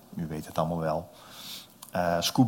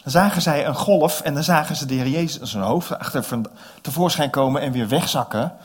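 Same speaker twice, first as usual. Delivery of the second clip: ordinary delivery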